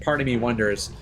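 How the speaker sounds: normal talking voice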